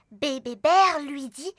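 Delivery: ordinary delivery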